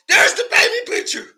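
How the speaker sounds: high mock-triumphant voice; mocking quote. Mimicking the crowd showing the picture, mock-triumphant. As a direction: parody the crowd's smug gotcha. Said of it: "And y'all got mad".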